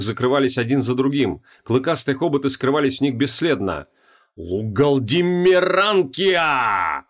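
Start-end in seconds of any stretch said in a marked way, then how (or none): 4.4-7.0 slow, stretched-out ritual shout. Acted. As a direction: yell the long name slowly. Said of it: "кричал Креол".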